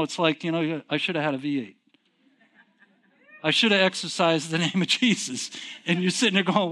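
usual speaking voice

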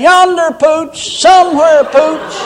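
high, loud comic holler; mock dog call. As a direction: call the dog absurdly, in earnest dog-calling pitch. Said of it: "he ought to be standing out there saying".

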